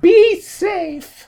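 very high imitation of fretting; mock worried warning in falsetto; Tori. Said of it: "where she's like".

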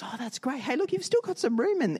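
high-pitched, chummy enthusiasm; opportunistic friend. Acted. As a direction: play a friend spotting spare room. Third friend eyes the trailer.

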